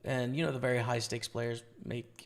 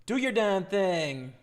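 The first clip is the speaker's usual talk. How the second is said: hype cheer; 5 semitones higher, 6 dB louder, slower than usual. Real, not acted. Cheering on a viewer.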